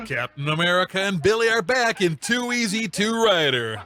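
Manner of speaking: normal talking voice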